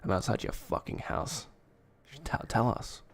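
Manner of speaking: unvoiced menacing whisper; creepy whisper. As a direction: whisper it like a stalker. Mock creepy threat to friend.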